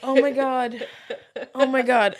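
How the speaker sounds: usual speaking voice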